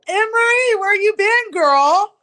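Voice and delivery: high, loud sing-song greeting; excited mock greeting. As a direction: squeal a greeting to a friend. Mocking greeting to recorded voice.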